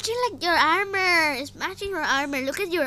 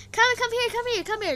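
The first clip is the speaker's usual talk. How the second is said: eager calling; 8 semitones higher, faster than usual. Real, not acted. Calling teammate over.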